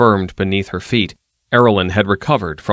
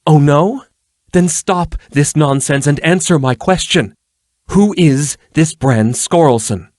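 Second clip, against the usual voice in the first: higher, louder challenge; angry demand; 8 semitones higher, 5 dB louder than usual. A narrator's reading. Erlin demanding that Danilo answer her question, angrily. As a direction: press hard for the answer.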